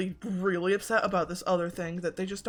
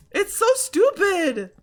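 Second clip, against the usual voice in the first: frustrated outburst; 11 semitones higher, 7 dB louder, slower than usual. Real, not acted. Venting frustration.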